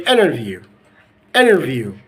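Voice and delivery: "interview" said as "innerview"; American dropped T. Shown as a demonstration. Teacher showing American accent, "interview" without its T. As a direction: leave the T out of "interview". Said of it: "So in the American accent".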